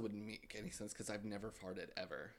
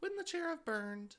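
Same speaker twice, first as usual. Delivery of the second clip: incredulous high voice. Retold or imitated. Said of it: "and I'm like".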